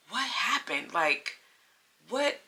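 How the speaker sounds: soft, puzzled question leaping up on the last "what"; baffled disbelief. Retold or imitated. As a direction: act out being quietly dumbfounded. Baffled reaction, retold.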